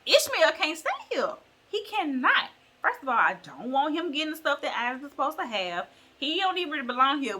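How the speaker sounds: high, loud, indignant demand as Sarah; Sarah laying down the law. Retold or imitated. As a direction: put your foot down, jealous for your son. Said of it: "And she was just like".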